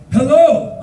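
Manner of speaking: loud, high-pitched hello; hearty greeting. Retold or imitated. Bus driver greets his only passenger, loud and high. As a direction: imitate a driver calling out hello.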